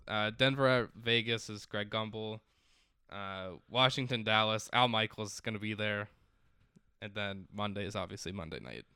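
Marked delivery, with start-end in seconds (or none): none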